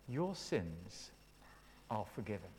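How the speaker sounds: very soft, low pronouncement with pauses; respectful, not condescending. Acted. Jesus forgiving the sick man, gentle and respectful. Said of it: "treated people with respect, not condescending".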